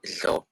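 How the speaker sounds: "Dlo" as one syllable, the dl a lateral click-free fricative; pronunciation model. Shown as a demonstration. Teacher showing learner how DLO sounds, isolated pronunciation model. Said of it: "you pronounce it as".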